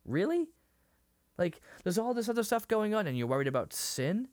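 high, disbelieving pushback; can't believe what he's hearing; incredulous. Retold or imitated. Nicodemus questions Jesus, high and disbelieving. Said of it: "he almost says it like".

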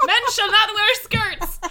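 mock commandment. Co-host invents a commandment, mock solemn. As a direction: proclaim it like scripture.

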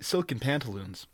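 plain reading voice

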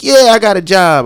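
loud, high mock confrontation; officer imitating a heckler at an arrest; heckling bystander. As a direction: play an angry young guy yelling at the cops. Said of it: "He's like".

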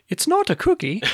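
put-on very high, squeaky kid voice; commercial kid voice. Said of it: "was that supposed to be an Australian accent".

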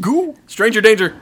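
high squeaky joking alarm; mock panic. Real, not acted. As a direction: yelp it in fake fright.

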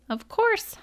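high-pitched huff. A narrator's reading. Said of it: "huffed Brer Whale".

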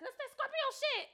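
high squeaky mimic of her; high imitating voice. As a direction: pitch up and mock her. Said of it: "she'll be like".

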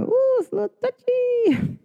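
"touchy" squeaked very high with a falling tail; squeaky touchy. Real, not acted. Reacting to viewers' touchy Pisces ascendant, high squeak.

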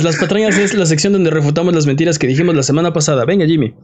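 usual speaking voice

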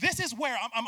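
ordinary talk, nothing marked

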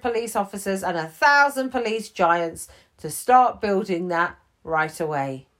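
loud, hearty police chief; booming chief. Acted. The chief approves Gibbons' prison idea, loud and hearty. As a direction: praise the idea like a big boss.

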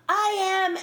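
high, loud, drawn-out star introduction. Retold or imitated. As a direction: announce it grandly in a high imitated voice. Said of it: "And he would go".

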